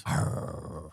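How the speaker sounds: low breathy hound dog groan; basset hound. Pretend. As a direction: grumble like a lazy old dog. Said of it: "you sound like a freaking hound dog laying on the porch".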